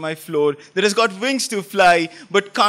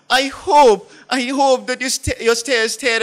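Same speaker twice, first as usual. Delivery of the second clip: passionate outburst; 4 semitones higher, 6 dB louder than usual. Acted.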